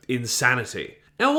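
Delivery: ordinary talk, nothing marked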